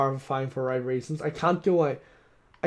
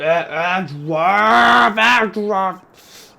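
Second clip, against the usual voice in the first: very slow, loud, higher bellow of the name; enraged roar; 5 semitones higher, 11 dB louder, much slower than usual. Acted. Von Karma exposed, bellowing at Edgeworth in rage.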